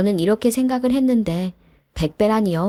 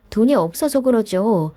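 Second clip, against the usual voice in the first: usual speaking voice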